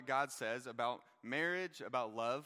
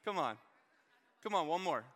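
louder, urging the crowd; calling out; 2 semitones higher, 6 dB louder than usual. Real, not acted. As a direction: call them up, cheering. Calling volunteers from the stage.

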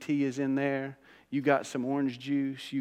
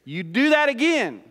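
raised, louder parental threat; parent's warning; 7 semitones higher, 6 dB louder than usual. Acted. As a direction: warn the kids like a fed-up parent. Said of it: "You ever done that with your kids?".

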